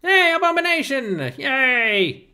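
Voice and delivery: high, bright call-out to a viewer; excited greeting. Real, not acted. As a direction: hail a friend who just arrived. Streamer greets a chat viewer.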